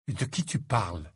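lightly voiced dazed friend; puzzled. A narrator's reading. Lovesick Ron asks Harry what he means, lightly puzzled. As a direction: ask in mild, dreamy puzzlement.

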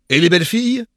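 put-on high, loud, boisterous old friend; a brash parvenu inviting his pal; jovial Patience. A narrator's reading. Patience's leering question. (ami bruyant et jovial)

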